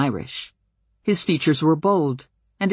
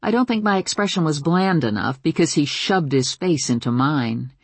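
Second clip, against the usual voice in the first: ordinary delivery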